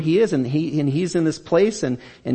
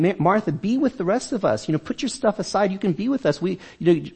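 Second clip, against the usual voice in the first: higher, easygoing friendly invitation; casual Jesus; 7 semitones higher than usual. Acted. Jesus inviting busy Martha to relax with guests, higher and casual. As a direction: coax her to drop the chores and join in. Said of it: "I just picture kind of Jesus sort of saying".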